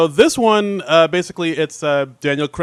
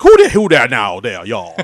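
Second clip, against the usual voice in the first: exaggerated Southern accent; 4 semitones lower, 5 dB louder than usual. Podcaster mimicking a sketch's dialect coach, exaggerated Southern accent. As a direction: imitate a comic's overdone Southern accent. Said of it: "Beck kinda doing these exaggerated, like, southern".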